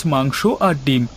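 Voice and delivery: ordinary delivery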